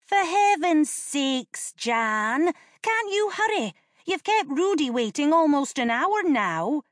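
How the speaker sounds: put-on high, impatient young boy; whiny kid brother hurrying his sister. A narrator's reading. Narrator voices impatient boy.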